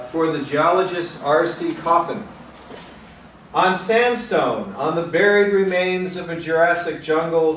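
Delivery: measured, projected poem recital; deliberate phrasing, line by line; poetry reading. Acted. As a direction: read it as a poem to an audience. Reads a poem aloud.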